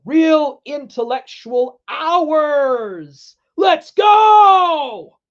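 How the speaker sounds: building, high-pitched cheer; hyped-up shout. Acted. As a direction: read it like a hype man, stretching out the last words. Hyped-up reading of a chat message.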